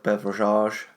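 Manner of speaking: "beverages" ending in a French-style "-ahzh"; "beverages" gentrified, last syllable drawn into "ahzh"; classed-up pronunciation. Real, not acted. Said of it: "Classed that way up, didn't you?".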